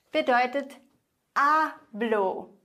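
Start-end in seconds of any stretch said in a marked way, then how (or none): 1.4-2.5 hablo split slowly, stressed A then falling blo; stress on first syllable. Shown as a demonstration. Teacher models "ich spreche", stressing the A in hablo. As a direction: lean on the A of hablo. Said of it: "Betonung auf der vorletzten Silbe".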